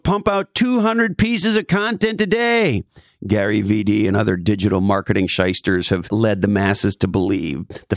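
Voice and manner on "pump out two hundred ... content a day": much higher, mocking a hype marketer; mocking guru quote. Mocking a digital marketing shyster, much higher. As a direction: parody the hustle guru's advice.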